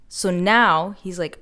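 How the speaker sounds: normal talking voice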